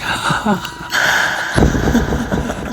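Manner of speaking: high-pitched hysterical witchy laugh; imitated ghostly cackle. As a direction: cackle harder and harder as the old woman. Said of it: "she just started laughing harder and harder".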